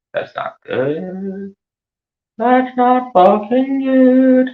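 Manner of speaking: very high, slow, drawn-out disapproval; high, slow mock horror; shocked high. Real, not acted. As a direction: stretch it out, aghast. Reacting to a shocking claim.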